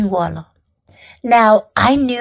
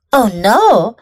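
ordinary delivery

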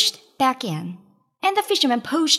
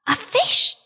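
high, bright cry of a catch; a fisherman's hopeful shout; excited cry; 9 semitones higher than usual. A narrator's reading. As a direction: call it out like you've hooked a big one. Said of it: "he cried".

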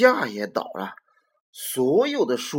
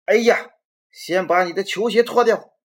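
loud, higher call from the kitchen; dad yelling; 6 semitones higher, 9 dB louder than usual. Acted. Dad shouts at Robert to take off his sneakers, loud. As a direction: holler the order at the boy. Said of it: "爸爸就在厨房里嚷嚷着".

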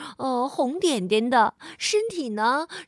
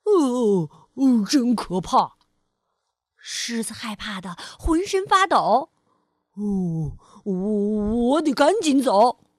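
trembling fear; 4 semitones lower, 3 dB louder than usual. Acted.

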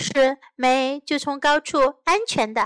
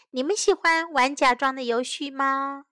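ordinary delivery

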